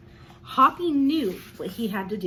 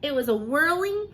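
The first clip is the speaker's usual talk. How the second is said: plain reading voice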